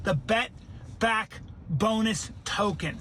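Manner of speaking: slow, spaced-out "Bet Back Bonus token"; word by word. Shown as a demonstration. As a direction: pause between Bet, Back and Bonus for emphasis. Repeats the name word by word.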